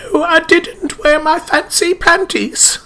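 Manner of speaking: imitating an actress's fake English accent; hammed-up English accent, high and drawn out; Bridget Jones English accent. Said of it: "I don't buy that accent for a second".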